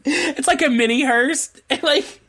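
giggling through the words; laughing. Real, not acted. Laughing at PT Cruiser getaway.

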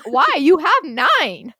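loud, slow exasperated disbelief. Retold or imitated. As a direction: stretch it out, loud and incredulous. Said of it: "And I'm like".

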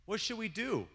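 lightly voiced startled mother; mock surprise. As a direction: play dumb with exaggerated worry. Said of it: "She said".

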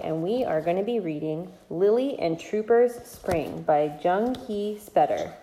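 ordinary delivery

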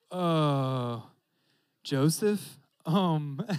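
drawn-out uh, halting and unsure; acting out a reluctant confession; hesitant news-breaking. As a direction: stall awkwardly before telling Joseph. Imagines Mary breaking news to Joseph.

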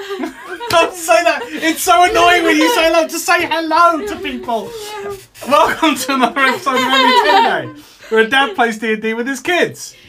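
high, loud, helpless giggly voice; squeaky-high words breaking up with laughter; laughing. Real, not acted. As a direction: talk through a fit of laughter. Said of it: "If you're wondering why we're laughing".

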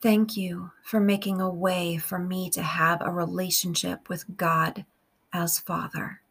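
slow, measured meditation with long pauses; guided prayer. Real, not acted. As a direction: pray phrase by phrase, unhurried, leaving room to repeat.

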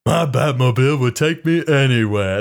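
hint of a simple-hearted Southern man; Forrest Gump voice. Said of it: "you're doing Forrest Gump".

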